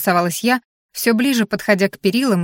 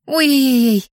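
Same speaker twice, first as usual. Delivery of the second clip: flustered; 5 semitones higher than usual. A narrator's reading. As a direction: flap and fuss in a hurry. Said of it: "Лена засуетилась". (суетливый возглас)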